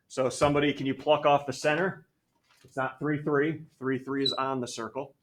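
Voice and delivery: ordinary talk, nothing marked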